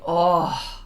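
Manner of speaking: low, drawn-out "oh" of awe; demonstrating an awestruck "oh"; awed exclamation. Shown as a demonstration. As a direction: let "oh" out as a gasp of awe. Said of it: "like that".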